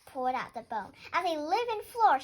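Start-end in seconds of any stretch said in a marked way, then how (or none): 1.1-2.2 high, loud astonished exclamation; exclaiming. A narrator's reading. Fox finds a talking bone, high loud astonished exclaim. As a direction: burst out in delight. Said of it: "she exclaimed".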